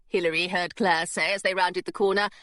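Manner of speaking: ordinary delivery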